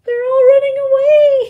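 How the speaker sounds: high, loud and fast alarm; panicked flee. A narrator's reading. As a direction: rush it as everyone escapes. Animals flee.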